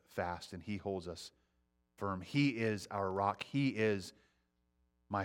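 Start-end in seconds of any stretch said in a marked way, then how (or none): none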